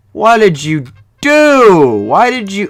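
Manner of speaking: high, loud, drawn-out disbelief; shocked outcry. Real, not acted. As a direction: cry out in dismay, stretching the words. Shocked by Yugoslavia's sudden breakup.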